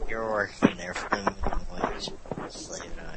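deep, hushed, half-whispered aside; low mock whisper. Egging on Edward behind the phone, low and whispered.

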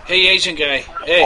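loud, eager hey; eager greeting. Acted. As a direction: call out to your buddy. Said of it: "I will play me".

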